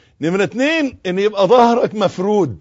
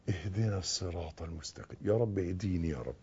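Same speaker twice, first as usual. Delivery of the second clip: hushed, low supplication; pleading prayer; 10 semitones lower, 19 dB quieter than usual. Shown as a demonstration. Teacher showing how to ask in prayer, hushed low plea. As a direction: ask God very softly, almost inaudibly. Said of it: "اطلب".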